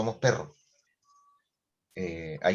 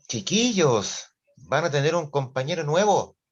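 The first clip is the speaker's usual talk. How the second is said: teacher's announcement; 6 semitones higher, 5 dB louder than usual. Retold or imitated. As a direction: stretch it out to grab the kids' attention. Teacher announces a new classmate.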